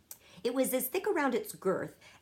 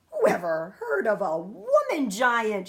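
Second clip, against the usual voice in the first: louder, booming giant shout; 4 semitones higher, 7 dB louder than usual. A narrator's reading. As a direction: shout it, swinging the snozcumber. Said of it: "shouted the B F G".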